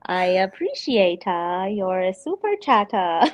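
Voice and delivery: exaggerated mimicry with swooping syllables; Cream Writer impression. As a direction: imitate a streamer, stretching the endings. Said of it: "This is the Cream Writer".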